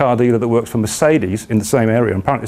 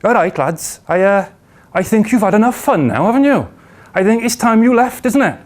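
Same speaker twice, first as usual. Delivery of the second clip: put-on high, lilting Cardiff accent; 7 semitones higher than usual. Car dealer telling two lads it's time to leave, Cardiff accent. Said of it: "I'm about to do a Cardiff accent".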